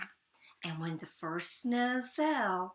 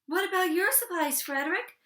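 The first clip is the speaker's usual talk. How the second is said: much higher, louder, quick plea; eager high question; 10 semitones higher, 7 dB louder, faster than usual. Acted. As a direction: ask fast, hungry and hopeful. Hungry mice ask eagerly.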